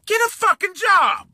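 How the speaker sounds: high, sharp barked command; shouted order. Real, not acted. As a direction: yell it at him. Shouting advice at rival.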